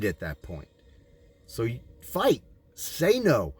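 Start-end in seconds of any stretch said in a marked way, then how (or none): 1.4-3.5 high, forceful exhortation building to scolding; fiery preaching. Acted. Preacher urging listeners to refuse stipends, fiery exhortation. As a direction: preach it hard, drive every command home.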